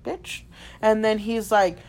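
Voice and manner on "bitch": muttered insult. Retold or imitated.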